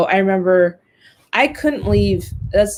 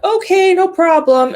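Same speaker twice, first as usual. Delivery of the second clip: mock breezy agreement; 10 semitones higher, 6 dB louder than usual. Mom casually agreeing on the phone.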